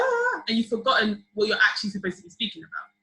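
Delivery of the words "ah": sudden high excited yelp; hyped cheer. Retold or imitated. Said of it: "everyone's like".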